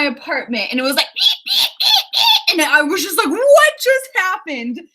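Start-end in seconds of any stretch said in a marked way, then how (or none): 1.2-2.6 very high-pitched little squeaks; mouse squeak. Retold or imitated. Telling viewers about the noise, imitated mouse squeak. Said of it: "I heard a mouse squeak". 3.3-4.8 drawn-out, high, louder startled question; startled disbelief. Retold or imitated. Retelling her freak-out to viewers, mock startled.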